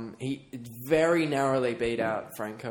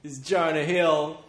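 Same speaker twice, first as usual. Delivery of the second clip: suspenseful awards presenter; 3 semitones higher than usual. Pretend. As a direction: build suspense, then land the name. Announcing the award winner.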